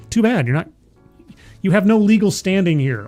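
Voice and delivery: ordinary delivery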